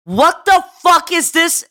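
exasperated outburst. Real, not acted. Exasperated retelling, loud high.

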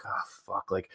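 mock deflated whisper; hushed gloomy curse; whispered dismay. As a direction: breathe it out like a let-down fan. Disappointed North Carolina fans.

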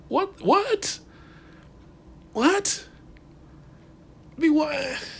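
very high-pitched, baffled repeated "what"; incredulous. Real, not acted. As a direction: squeak it out in exaggerated disbelief. Baffled by wrestler explaining gimmick.

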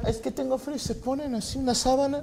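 put-on high, soft old lady whine; imitating old ladies. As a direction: whimper like a chilly old lady. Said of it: "Y con esos huevos que están".

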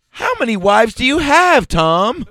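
high, loud hammed-up question; exaggerated high-pitched questioning; mock TV line. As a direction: ask it like a sitcom promo. Mock TV show promo line.

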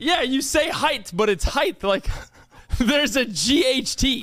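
"height" said with a lisp, slowly. Shown as a demonstration. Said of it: "When did you get a lisp?".

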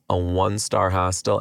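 ordinary talk, nothing marked

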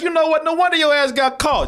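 high-pitched comic telling-off; mock outrage. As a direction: call him out in a high, exasperated voice. Mock reaction to the film villain.

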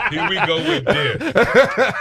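drawn-out laughing groan. Real, not acted. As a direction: stretch it slowly through a laugh. Mock groan at talk topic.

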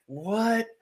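high-pitched, bewildered "what"; baffled reaction. Retold or imitated. Soldier retelling finding glass in his arms, baffled reaction. Acting out his dazed confusion.

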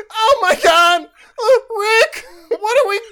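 Morty impression. Co-host doing Morty instead of Shaggy, high and panicky. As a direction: whine it like Morty. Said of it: "Morty impression".

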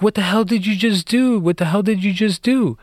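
high mock yell, panicked; frantic imitated scream; retold screaming. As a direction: shriek it in a hammed-up panic. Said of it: "started screaming on the phone".

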